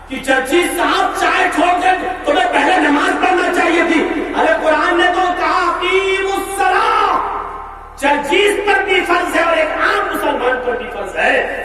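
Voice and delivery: full-force pulpit rebuke; fiery preaching. Acted. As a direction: preach it hard at the crowd.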